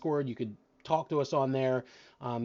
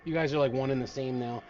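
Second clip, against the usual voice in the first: ordinary delivery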